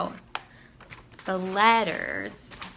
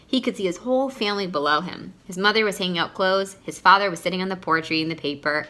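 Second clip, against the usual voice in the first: usual speaking voice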